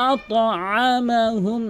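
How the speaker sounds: "আত'আমাহুম" recited with an echoing qalqala. Shown as a demonstration. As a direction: bounce the ta in "আত'আমাহুম" and stretch it. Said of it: "আত এভাবে".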